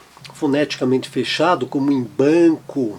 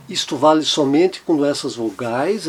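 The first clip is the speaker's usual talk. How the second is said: ordinary talk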